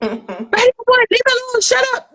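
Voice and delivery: a squeaky high, teasing brush-off voice; high mock brush-off. Telling the inner voice to leave her alone, high and teasing. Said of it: "And I'm like".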